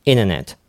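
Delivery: "internet" said as "inner-net"; T dropped. Shown as a demonstration. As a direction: swallow the T so it's "innernet". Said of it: "パターンの三つ目". (「イナーネット」のように発音)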